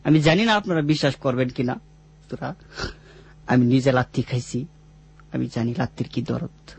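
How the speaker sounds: usual speaking voice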